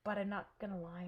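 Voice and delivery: usual speaking voice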